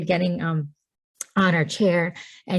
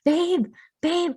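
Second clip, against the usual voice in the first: retold yelling; 10 semitones higher than usual. Laboring wife calling husband from the tub, high mock yell. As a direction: imitate calling urgently from another room. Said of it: "So I start yelling for Justin".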